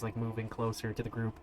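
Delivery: ordinary talk, nothing marked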